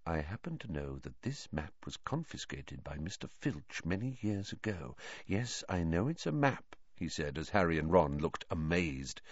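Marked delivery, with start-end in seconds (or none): 0.1-6.7 much softer, low confidential tone; lowered voice. Acted. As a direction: drop your voice so no one hears. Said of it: "lowered his voice".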